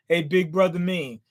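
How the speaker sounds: normal talking voice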